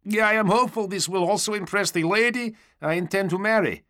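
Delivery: high, buoyant trooper voice; hopeful stormtrooper suitor. A narrator's reading. Stormtrooper confiding marriage hopes to the knight, high blithe voice.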